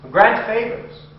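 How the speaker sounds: a sudden high, loud cry to the dark gods; loud invocation. Acted. Vampire calling on the gods of darkness, loud high cry. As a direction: fling the plea upward like a command.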